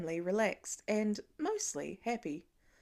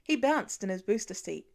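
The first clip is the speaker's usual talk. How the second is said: usual speaking voice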